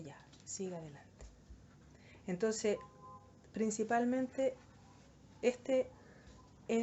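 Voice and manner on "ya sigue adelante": the Lord's words whispered gently; soft whisper. Retold or imitated. The Lord lifting up the fallen sinner, whispered softly. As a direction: breathe it as gentle encouragement.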